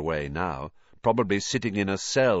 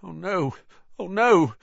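high wail building to loud; rising panic; 10 semitones higher, 6 dB louder than usual. A narrator's reading. Ron panicking as something big approaches, rising panic. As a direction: climb from worried cry to loud panic.

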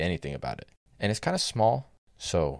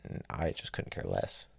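whispered, deadpan admission; whispered aside; 7 semitones lower, 6 dB quieter than usual. Real, not acted. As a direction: drop to a whisper of pure indifference. Admitting total indifference.

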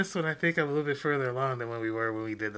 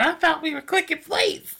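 imitating the friend; 7 dB louder than usual. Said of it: "picked up her plate and said".

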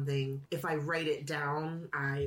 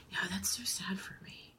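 unvoiced, softer self-pity; mock sad whisper; 4 semitones higher, 6 dB quieter than usual. Real, not acted. After reading the definition, half-joking sad whisper. Reading word means without imagination.

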